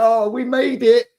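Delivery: high, slow and loud mock relief; mock delighted wife. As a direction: ham up the wife's happy sigh of arrival. Voicing wife's delight at hotel.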